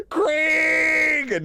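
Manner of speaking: high, loud welcoming shout; excited shout. Real, not acted. Streamer shouts happily to welcome a raiding friend. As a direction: yell the name out in delight.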